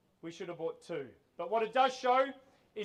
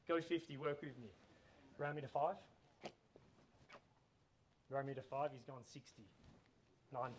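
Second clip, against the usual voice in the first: quiet bid updates; 5 semitones lower, 7 dB quieter than usual. Real, not acted.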